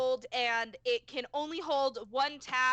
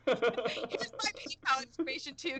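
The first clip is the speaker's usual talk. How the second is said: laughing; slower than usual. Real, not acted. Joking plug, laughing.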